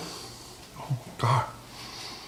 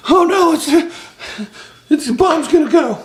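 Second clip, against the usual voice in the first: mock panic. Mock panic over beeping.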